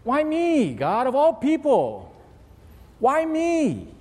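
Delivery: high, pleading complaint to God, mock self-pity; whiny why me. Believer complaining to God about troubles, high whiny self-pity. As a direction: whine it like a wronged victim. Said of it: "Something happens and we go".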